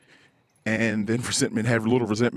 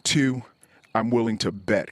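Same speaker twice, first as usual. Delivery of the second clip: ordinary delivery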